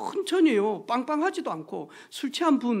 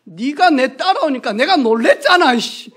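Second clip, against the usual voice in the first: loud laughing mock yell; imitating drunk man's yell; 4 semitones higher, 9 dB louder than usual. Preacher mimics a drunk man yelling at him, loud mock yell. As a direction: ham up the drunk's angry complaint. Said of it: "그랬더니 하는 말이 있어요".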